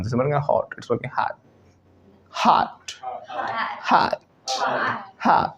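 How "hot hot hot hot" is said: "hot" with a long aa, like haat; American open vowel. Shown as a demonstration. Teacher demonstrates American sound, "hot" with an open aa. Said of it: "आ का sound देते हैं American".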